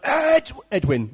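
dad on the touchline. Retold or imitated. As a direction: imitate a dad calling across the pitch. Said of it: "my dad would stand on the touchline".

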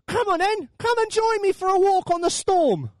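acted holler to a friend; calling back. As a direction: shout an invitation over the storm. Jesus calls back to Peter.

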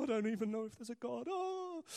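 rising wail of mock exasperation; hammed-up doubting groan; mock despair. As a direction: moan it, climbing high at the end. Mimics own doubting outburst to God.

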